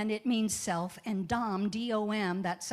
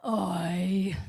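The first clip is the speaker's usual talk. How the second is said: sighing oy; 6 dB louder than usual. Acted. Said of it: "takes a deep sigh and says".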